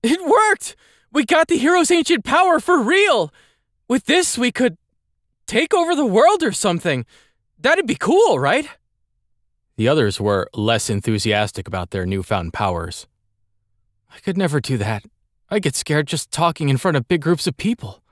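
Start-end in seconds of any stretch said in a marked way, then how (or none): 0.0-8.7 put-on high, excited boy voice; excited young boy. Andel was thrilled. 14.1-18.0 put-on timid boy. Monty, scared.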